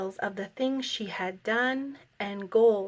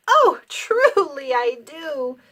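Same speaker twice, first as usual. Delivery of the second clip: hooting; 4 semitones higher, 6 dB louder, slower than usual. A narrator's reading. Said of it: "Fred hooted".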